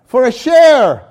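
preacher proclaiming scripture; preaching fervently. Acted. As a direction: ring out the promise. Reading Colossians with force.

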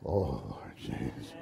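low, soft, slow moan of the name; low soft groan. Shown as a demonstration. Preacher contrasting a groaning wake-up, low and soft. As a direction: sink into a tired murmur. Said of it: "Like this".

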